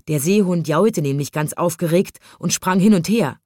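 usual speaking voice

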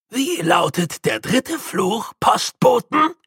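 slow build to a high, loud triumphant cry; Grütze crowing triumphantly at the trapped postman; triumphant call. A narrator's reading. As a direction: crow it out like a gleeful old postman. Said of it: "rief Grütze triumphierend".

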